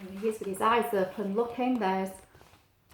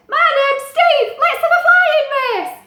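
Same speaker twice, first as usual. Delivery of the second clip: high, loud chirpy bird voice; little bird voice; 12 dB louder than usual. Acted. Little bird greeting Pete, high and loud. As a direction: squeak it out like a tiny bird. Steve the bird invites race.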